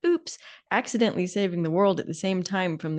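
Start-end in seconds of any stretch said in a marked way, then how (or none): none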